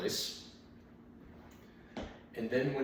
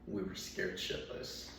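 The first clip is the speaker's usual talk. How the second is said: ordinary delivery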